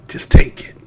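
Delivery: whispered, sarcastic; mock whisper. Mocking being told to silently take it, whispered. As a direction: breathe it out in a whisper.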